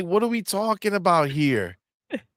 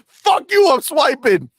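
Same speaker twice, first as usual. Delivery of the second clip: higher, louder defiant yell; defiant shout; 7 semitones higher, 4 dB louder than usual. Retold or imitated. Jim yelling back at critics, higher and louder. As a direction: fling it back as a cocky shout. Jim's defiant retort retold.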